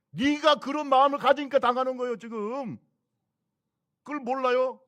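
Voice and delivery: loud, high scolding rebuke; preacher loudly scolding the complainer. Real, not acted. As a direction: shout down the grumbler.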